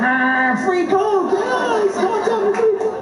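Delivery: very high, excited squeal of words; mock elation. Comic acting out his windfall, hammed-up elation. Acting out joy at free coke.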